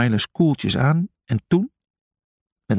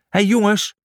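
put-on higher-pitched call to the boys; 8 semitones higher, 3 dB louder than usual. A narrator's reading. Julius to the dancing friends, calling out. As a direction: call over to them, voice raised in pitch. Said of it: "riep Julius".

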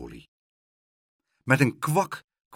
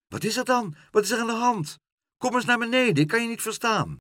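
high-pitched, concerned questioning; calling up; 12 semitones higher, faster than usual. A narrator's reading. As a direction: call up to someone overhead. Pluk calls up to the gull. (hoog omhoog roepen)

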